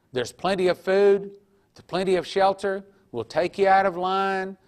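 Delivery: guard tempting prisoners in line, coaxing loudly; coaxing tempter's offer. Retold or imitated. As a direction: lure them with a loud, bright offer. Tempters coaxing Christians to deny.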